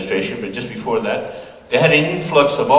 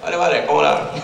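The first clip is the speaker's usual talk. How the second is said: Castilian Spanish, lips barely moving; a lightly played Spaniard greeting a friend; mock Castilian Spanish; 3 semitones higher, faster than usual. Mocking Spaniards who keep a stiff upper lip, in a light Castilian accent. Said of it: "Son los españoles también".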